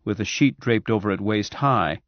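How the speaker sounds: usual speaking voice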